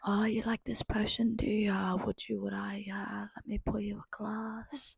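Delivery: breathy close-mic whisper; imitating an ASMR tavern roleplay; mock ASMR whisper. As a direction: whisper to the traveller like an ASMR video. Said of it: "A S M R".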